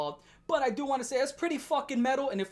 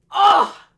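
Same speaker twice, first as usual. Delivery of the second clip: mock shocked yelp; 11 semitones higher, 17 dB louder than usual. Mock shock at bold move.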